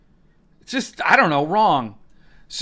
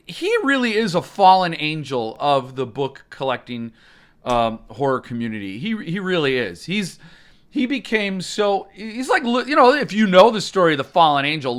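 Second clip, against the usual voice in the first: normal talking voice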